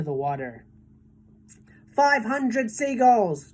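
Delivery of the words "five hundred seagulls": high, slow triumphant call; shouted count. A narrator's reading. James calling the seagull count to everyone, slow and loud. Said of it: "James shouted".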